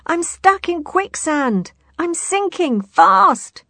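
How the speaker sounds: high, slow panicked cries; sinking in quicksand. Acted. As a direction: cry out while being sucked down.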